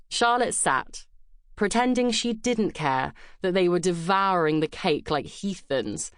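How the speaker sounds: plain reading voice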